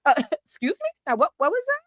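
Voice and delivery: high, fast, offended disbelief; pearl-clutching indignation. Retold or imitated. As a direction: play the scandalized lady clutching her pearls. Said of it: "I clutch my imaginary pearls".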